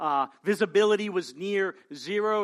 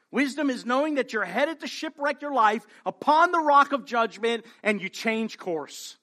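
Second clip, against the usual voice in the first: ordinary talk, nothing marked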